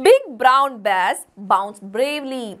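very slow, each B word pressed; slow alliteration reading. Shown as a demonstration. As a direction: read it word by word for kids. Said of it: "ബ്, ബ്, ബ് sound വന്നു".

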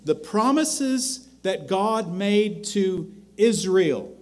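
a fervent pulpit crescendo; preaching at full force. Acted. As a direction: swell the list of God's promises toward a peak. Preaching builds to climax.

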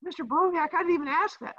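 high, whiny student asking; teacher mimicking a student's high whiny question; mock student voice. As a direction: play the pesky kid raising a question.

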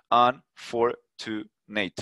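"Unfortunate" very slowly, split into four separate syllables. Shown as a demonstration. Teacher counting a word's syllables for students, "Unfortunate" broken into four. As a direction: count out each syllable of "Unfortunate" with gaps between. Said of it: "¿cuántas sílabas tiene unfortunate?".

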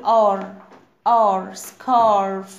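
"R" as a long open "ar", repeated, then "scarf"; AR sound. Shown as a demonstration. Teacher showing the sound of A R, long "ar" then "scarf". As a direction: hold "ar" firmly, pause, then stretch it in "scarf". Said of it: "صوت ال اي ار بقول".

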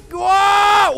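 long high loud cry mid-swing; scared yell. Real, not acted. Swinging on the grab hand over the pit, yell. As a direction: scream as you fly across.

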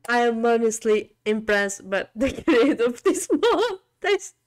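can't stop laughing while talking; laughing so hard the voice cracks; laughing helplessly. Real, not acted. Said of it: "I don't know why I'm laughing".

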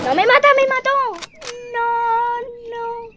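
high, loud wail; shriek, then draw out a wailing "no"; dismayed shriek. Real, not acted. Player killed in the game, wailing in dismay.

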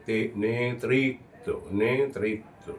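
নেতৃত্ব slowly, তৃ said only once, no doubled ত; slow careful pronunciation. Shown as a demonstration. Teacher showing the correct sound: slow pronunciation. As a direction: stretch out তে- then say নেতৃত্ব cleanly. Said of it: "তো একবারই হবে".

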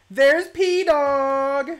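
very loud, drawn-out squealed greeting; high-pitched yell. Real, not acted. Streamer greeting the visiting kid, high-pitched yell. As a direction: yell it high, right into the mic. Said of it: "yelled very high pitched right into the".

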